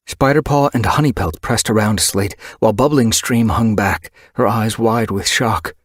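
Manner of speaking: plain reading voice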